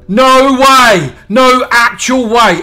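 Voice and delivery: loud, high-pitched shout of disbelief; stretched-out, amazed yell; astonished. Real, not acted. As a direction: cry out, stunned by your luck. Astonished at lucky in-game find.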